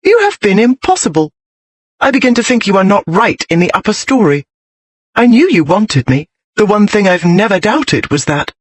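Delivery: spirited wifely telling-off; indignant wife. Acted. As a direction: scold your husband with rising exasperation. Voices Jessica's indignant tirade.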